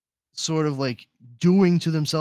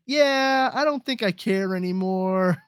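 mock disinterest; 8 semitones higher, 4 dB louder than usual. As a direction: stretch it out high, like backing away politely. Voicing his own lost interest.

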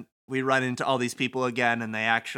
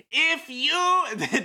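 much higher, far-carrying declaimed impression; David Lynch; 14 semitones higher, 4 dB louder than usual. Host breaks into the day's announcement, David Lynch impression. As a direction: stretch it out in a high, odd announcing voice. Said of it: "David Lynch comes and says".

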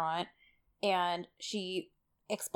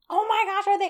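much higher, breathless thrill; excited disbelief; 12 semitones higher, 4 dB louder than usual. Retold or imitated. Texting her friend about the episode, excited and much higher. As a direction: gush like it's four in the morning. Recalling her late-night text.

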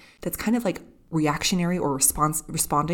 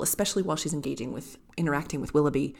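ordinary talk, nothing marked

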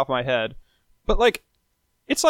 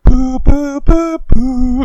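loud, high "boo" horn toots; imitated horn sound; 8 semitones higher, 11 dB louder, much slower than usual. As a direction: toot it like a festive horn section. Imitating fake horn parts.

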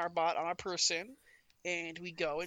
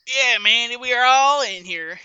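loud, higher-pitched call over the radio; loud radio reply; 5 semitones higher, 13 dB louder than usual. Acted. Player answers Mandy on the walkie-talkie, loud radio reply.

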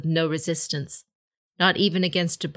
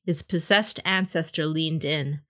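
plain reading voice

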